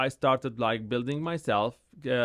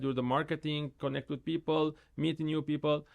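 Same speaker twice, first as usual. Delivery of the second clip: usual speaking voice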